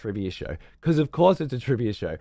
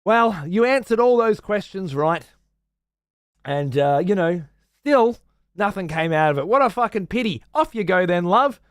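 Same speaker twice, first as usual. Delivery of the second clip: high, loud patronizing presenter; mock condescending host; 8 semitones higher, 4 dB louder than usual. Host dismissing the losing contestant, high and loud. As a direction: brush the contestant off sweetly. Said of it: "host presenter".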